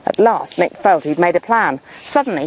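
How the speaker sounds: usual speaking voice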